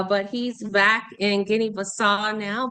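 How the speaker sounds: usual speaking voice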